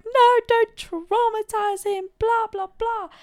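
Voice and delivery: high, loud imitation of fussing relatives; mock protesting relatives. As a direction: wail it in a high mock protest, ending in blah-blahs. Acted protest of family members.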